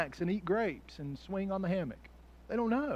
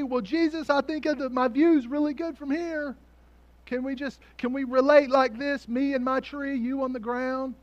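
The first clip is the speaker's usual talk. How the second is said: Zacchaeus pleading; 7 semitones higher, 5 dB louder than usual. Pretend. Voicing Zacchaeus bargaining from tree.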